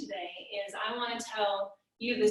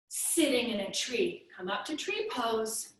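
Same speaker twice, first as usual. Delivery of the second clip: usual speaking voice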